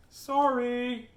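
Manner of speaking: high, stretched-out "sorry"; singsong apology. Acted. Helen to the wolf she sprayed, high singsong apology. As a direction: draw it out high, like an oops.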